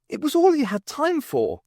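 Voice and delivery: a hint of Sarah. Sarah telling the men outside, lightly voiced young woman.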